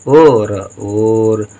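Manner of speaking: very slow, stretched out; drawn out syllables. Shown as a demonstration. Teacher dictating a Hindi word to pupils, drawn out slowly. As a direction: sound it out slowly for the class. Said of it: "teacher sounds out word".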